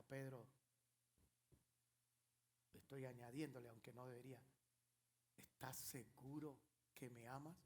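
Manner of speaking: soft and low; the Lord asking Peter a third time, hushed; hushed question. Acted. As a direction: ask it almost in a whisper.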